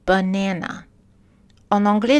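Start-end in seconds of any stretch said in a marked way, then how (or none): 0.1-0.8 "banana" said the English way; English "banana", middle syllable stressed, outer vowels reduced; English pronunciation. Shown as a demonstration. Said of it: "avec l'anglais".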